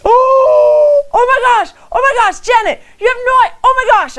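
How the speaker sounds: high, loud retold squealing outburst; freaking out. Woman shown the socks by her friend, freaking out loudly. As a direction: re-enact an overjoyed shriek at a gift. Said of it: "I was freaking out".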